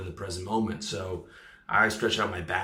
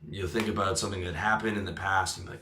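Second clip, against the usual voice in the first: ordinary talk, nothing marked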